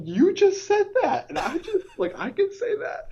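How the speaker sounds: very high comic squeak; flustered mock protest. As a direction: squeal it in pretend embarrassment. Retells squeaky protest about the word.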